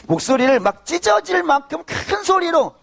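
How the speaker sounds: high-pitched, strained emphatic preaching; straining cry. Shown as a demonstration. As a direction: push the voice up high as if tearing. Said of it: "제가 평소에 하는 스타일 있지 않습니까".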